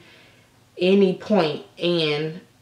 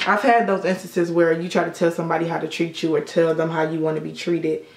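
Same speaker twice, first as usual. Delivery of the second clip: ordinary talk, nothing marked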